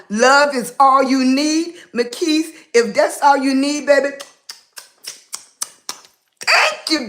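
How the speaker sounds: ordinary talk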